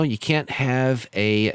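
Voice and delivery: usual speaking voice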